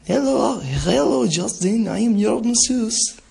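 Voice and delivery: high, loud mock Russian accent; Russian masseuse accent. Streamer pranking as a masseuse, hammed-up Russian accent. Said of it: "I am Russian for some reason".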